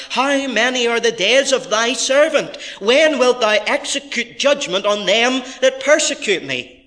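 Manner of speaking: high, loud declaimed appeal; impassioned plea. Acted. Preacher reading the persecuted psalmist's words to God, impassioned plea. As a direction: demand an answer from God.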